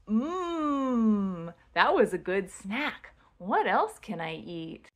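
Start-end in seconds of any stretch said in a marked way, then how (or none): 0.1-1.5 yummy hum. Pretend. Pretending to eat crackers with a child, savoring hum. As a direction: hum with exaggerated relish.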